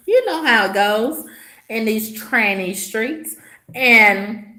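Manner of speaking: ordinary delivery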